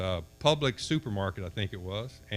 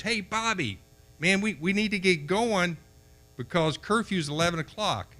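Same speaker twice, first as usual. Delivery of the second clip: high, carrying call to a friend far away; retold shout down the beach, pitched high; yelling down the beach; 7 semitones higher than usual. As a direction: call out across the sand so he hears. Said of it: "So I yelled down the beach".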